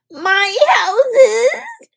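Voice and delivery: high dismayed cry of a small bird; woodpecker exclaiming. A narrator's reading. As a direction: woodpecker seeing his ruined houses, crying out high. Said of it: "exclaimed Woodpecker".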